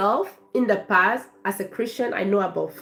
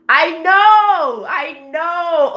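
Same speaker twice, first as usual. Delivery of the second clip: excited agreement; 8 semitones higher, 8 dB louder, much slower than usual. Real, not acted. Reacting to chat, excited.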